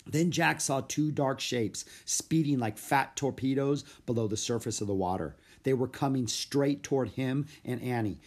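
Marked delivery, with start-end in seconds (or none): none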